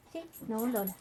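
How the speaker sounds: ordinary talk, nothing marked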